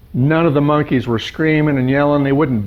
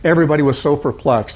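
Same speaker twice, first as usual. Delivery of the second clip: usual speaking voice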